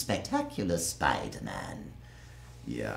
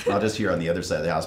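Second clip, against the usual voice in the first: ordinary delivery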